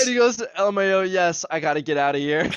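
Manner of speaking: high, loud, tickled delivery; worked up and cracking up between phrases; amused retelling. Real, not acted. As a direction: read the reply out on the edge of laughter. Cracking up while retelling text exchange.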